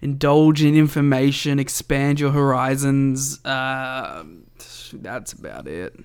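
normal talking voice

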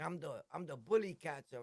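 usual speaking voice

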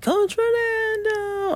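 put-on high, slow, drawn-out kid's call; sing-song child voice; child's call. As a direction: stretch it high like a little girl from her stroller. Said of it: "she was like".

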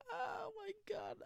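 shrieking oh my god. Real, not acted. Reacting to sister's ugly joke.

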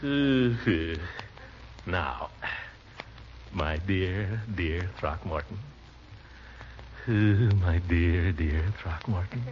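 tender, drawn out. Acted. Drafting a love letter aloud.

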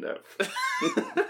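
high squealing laugh. Real, not acted.

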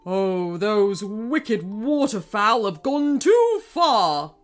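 slow, loud bawled complaint; bawling king. Acted. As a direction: bawl it like an enraged king. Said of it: "bawled King Krusty".

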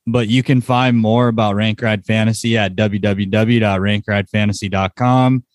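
usual speaking voice

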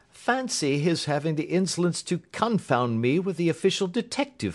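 Holmes, lively indignation then brisk. Acted. Holmes makes plans with Watson, lively and brisk.

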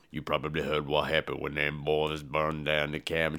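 low, soft storyteller's rumble; deep Tony Todd voice. Retold or imitated. Tony Todd's character tells the backstory, low and soft. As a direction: drop way down and spin the tale low. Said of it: "Tony Todd's like".

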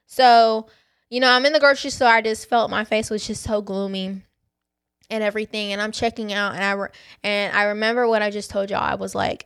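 usual speaking voice

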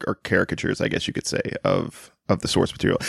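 ordinary talk

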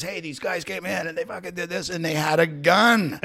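high, worked-up retold phone rant; mock mad complaint. Restaurant owner phoning the cops about non-payers, mock mad. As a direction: imitate an owner riled up on the phone. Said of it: "he's so mad".

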